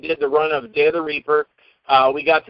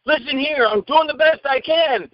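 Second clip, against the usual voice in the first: higher-pitched imitation of an indignant comeback; mock argument; 8 semitones higher than usual. Cameraman to director in staged row, mock indignant. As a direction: act out a cameraman arguing back. Retold cameraman arguing back.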